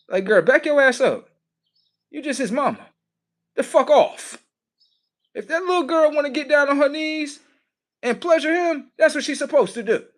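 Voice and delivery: high, loud, scolding mama voice; mama shooing the girl. Retold or imitated. Defending the son's fun, as high furious mama. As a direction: play the mother chasing the girl off, shrill and high.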